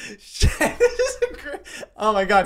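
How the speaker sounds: high laughing voice breaking through words; giggling at the memory. Real, not acted. Laughing while retelling.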